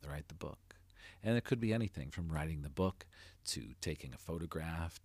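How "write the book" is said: soft inner nudge, fading on the repeat; hushed, lightly voiced inner prompting; little inner voice. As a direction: say it quietly, like a thought underneath. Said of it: "that little voice inside was saying".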